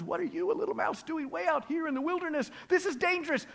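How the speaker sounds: high-pitched, incredulous warning; alarmed raccoon. Acted. Raccoon warns little mouse in wilderness, high and alarmed. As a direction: question the intruder in a high, alarmed voice.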